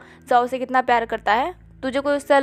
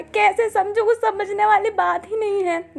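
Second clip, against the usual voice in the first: higher, teasing imitation of his sister; mocking her; 5 semitones higher than usual. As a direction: mimic her bouncy whine to tease. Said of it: "उसका नकल करके बोलता है".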